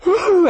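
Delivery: sudden high-pitched "whew" of relief; lady's high exhale as she breaks down; high relieved whew. Retold or imitated. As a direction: let out a squeaky sigh of release. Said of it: "this lady went".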